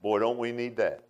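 usual speaking voice

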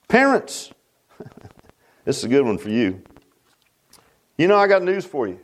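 normal talking voice

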